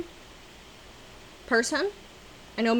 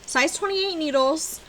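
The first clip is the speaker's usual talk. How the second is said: ordinary delivery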